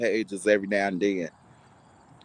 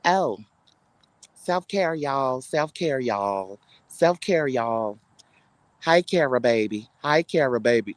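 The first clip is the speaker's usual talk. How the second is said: mocking Creesha's live; slower than usual. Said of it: "you get on the live talking about some".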